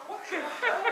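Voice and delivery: high, loud squeal through laughter; laughing disbelief. Retold or imitated. New hire reacting to the boss's crude threat, laughing high squeal.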